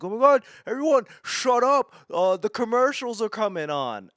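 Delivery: high, loud, fast shrieking mock of a fan; mock annoying partygoer. Comic mocking a Super Bowl guest hushing everyone, loud mock shriek. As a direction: parody a shrill commercial-obsessed party guest. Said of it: "everyone's like".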